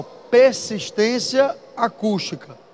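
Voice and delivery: "Persistência acústica" drawn out very slowly, syllable by syllable; slow dictation. Shown as a demonstration. Teacher dictating the term to note down, very slow. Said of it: "Coloquem assim, ó".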